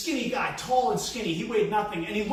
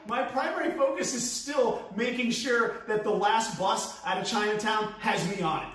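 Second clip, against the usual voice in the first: normal talking voice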